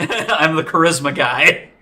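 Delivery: proud player laughing at his charisma build; laughing boast. Real, not acted. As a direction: laugh through the brag.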